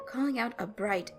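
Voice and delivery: plain reading voice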